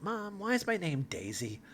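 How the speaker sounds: put-on soft, high question from a kid; child asking mother. Second daughter asking her mother about her name, lightly childlike. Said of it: "second daughter runs over and asks her mom".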